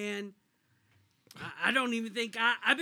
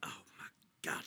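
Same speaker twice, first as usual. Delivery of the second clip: whisper; 10 dB quieter, much faster than usual. Acted. Reacting to crude name.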